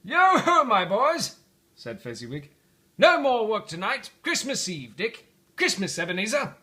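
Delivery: loud, high and merry; jolly shout. Acted. Fezziwig announces Christmas Eve to apprentices, jolly. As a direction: cheer the boys on to the party.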